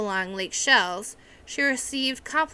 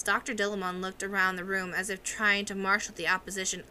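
usual speaking voice

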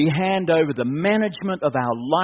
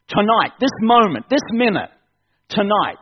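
a burst of louder urgent preaching; sudden surge; 4 semitones higher, 5 dB louder than usual. Real, not acted. Preacher pressing tonight's decision, a sudden loud surge. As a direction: hammer each word of the moment home. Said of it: "Whoa, where'd that come from?".

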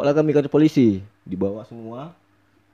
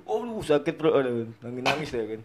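ordinary talk

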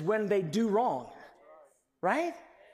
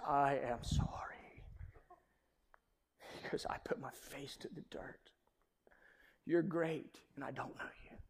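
hushed unvoiced whisper, much softer; whispered humility; 4 semitones lower, 10 dB quieter than usual. Job whispering his apology to God. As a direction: barely breathe the words out, humbled.

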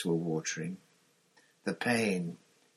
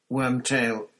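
plain reading voice

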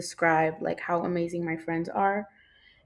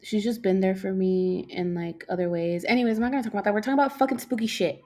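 ordinary talk

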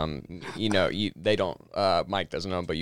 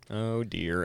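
mild dismay; 8 dB quieter than usual. Retold or imitated. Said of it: "doesn't swear or anything at all".

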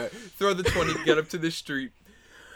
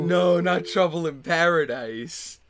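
loud, slow melodramatic lament; hammed-up heartbreak, words drawn out; mock anguish; 3 semitones higher, 5 dB louder, slower than usual. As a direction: wail it like a soap-opera tragedy. Mock despair after hang-up.

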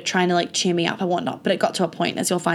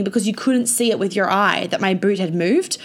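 ordinary talk

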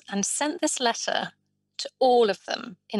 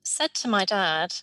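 usual speaking voice

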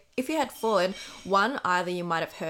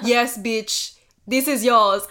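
louder, gleeful yes bitch; triumphant; 2 semitones higher, 8 dB louder, slower than usual. Retold or imitated. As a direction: announce it like a win. Handing back the earring.

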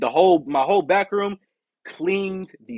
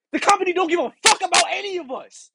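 high, loud angry outburst; real anger; 14 semitones higher, 5 dB louder than usual. Real, not acted. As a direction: let the frustration explode. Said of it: "before I get mad again".

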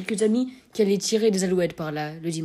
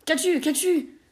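stammering; 8 semitones higher, slower than usual. A narrator's reading. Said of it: "Il bégaya".

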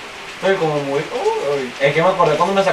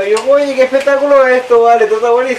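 higher, louder delighted gush; louder excited delight at the peanuts; 6 semitones higher, 5 dB louder than usual. Retold or imitated. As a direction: gush about the show with glee. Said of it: "Yo me quería morir".

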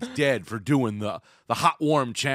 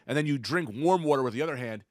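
ordinary delivery

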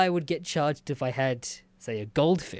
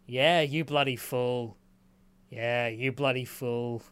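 imitating the duck; 4 semitones lower, slower than usual. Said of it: "while just saying".

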